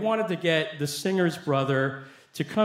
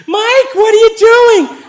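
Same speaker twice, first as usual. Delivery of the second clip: high-pitched shriek at full volume; wife's yell; 15 dB louder, slower than usual. Retold or imitated. Wife yelling from the kitchen at her husband, shrieking. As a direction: scream it out in alarm, drawn out. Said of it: "turns around and she yells".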